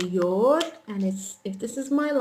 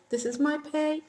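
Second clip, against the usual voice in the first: normal talking voice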